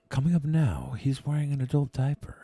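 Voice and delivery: soft hushed golf announcer; golf commentator. Pretend. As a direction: call it like a quiet golf broadcast. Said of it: "You sound like a golf announcer".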